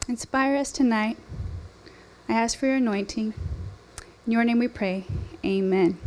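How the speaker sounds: hushed, earnest prayer to God. Real, not acted. Opening prayer before sermon.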